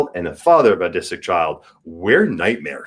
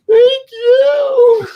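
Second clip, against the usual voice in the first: high loud laughing near-sob of thanks; overjoyed thanks; 9 dB louder than usual. Real, not acted. Thanking fans who love his voice, laughing and tearful. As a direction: burst out thanking with laughter and tears. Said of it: "it's just".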